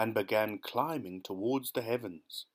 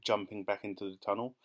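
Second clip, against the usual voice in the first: usual speaking voice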